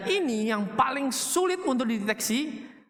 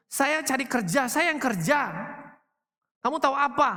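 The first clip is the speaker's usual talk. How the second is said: higher, scornful put-down of a spouse; imitating a husband who belittles his wife; boastful husband; 6 semitones higher than usual. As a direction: lord it over your wife, mock-arrogant. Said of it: "bapak-bapak ngerasain juga tuh kata-katanya".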